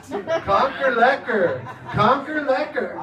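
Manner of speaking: Kankerlekker drawn out slowly, laughing; slow repeated Dutch word. Shown as a demonstration. Puzzled comedian repeating Dutch slang, slow and laughing. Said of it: "repeats the word in disbelief".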